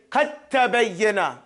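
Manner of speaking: "Qad tabayyana" slow with no d, a doubled t; dal merged into ta. Shown as a demonstration. Teacher showing the dal dropped into the ta, slow. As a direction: say "Qad tabayyana" slowly, drop the d into a held t. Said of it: "There's no del now".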